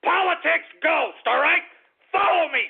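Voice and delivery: high, forceful demand; worked-up insistent yell; irate plug. Real, not acted. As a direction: order the listeners around angrily. Barking plug at scumbag listeners.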